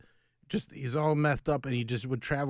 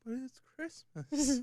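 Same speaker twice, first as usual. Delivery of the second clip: high, slow mock plea; pleading; 10 semitones higher, 4 dB quieter, slower than usual. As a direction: plead with a cop in a put-on high voice. Pleading excuse to a cop.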